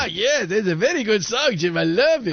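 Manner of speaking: high, hammed-up Mexican accent; excitable mock Mexican accent. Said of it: "do you do Mexican accent when we play Latin music".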